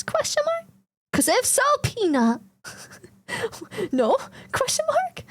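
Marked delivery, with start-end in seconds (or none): 0.0-0.7 high squeaky mock question. 4.5-5.2 high squeaky mock question.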